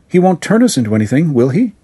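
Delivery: put-on small girl pleading with runner, anxious; anxious little girl. A narrator's reading. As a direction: hurry it like a scared child. Caroline voiced by narrator.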